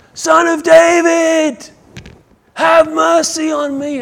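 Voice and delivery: high, drawn-out desperate shout; loud acted scream for mercy; screaming at top of voice. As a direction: yell it out over a crowd. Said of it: "he starts screaming at the top of his voice".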